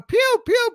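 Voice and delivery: high, playful pew sounds; mock blaster noise. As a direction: imitate toy gun shots. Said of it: "running around going".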